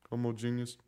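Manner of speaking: ordinary talk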